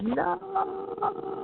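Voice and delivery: sudden high-pitched cry of no; desperate cry. Retold or imitated. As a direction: shriek it high in panic. Ego clawing, desperate cry.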